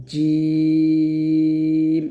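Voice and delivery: জিম held very long on one steady chanted note; prolonged জিম. Shown as a demonstration. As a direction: stretch the vowel of জিম slowly and evenly. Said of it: "জিম উচ্চারণ করার সময় অবশ্যই একটু টান দিবেন". (লম্বা টান দিয়ে জিম)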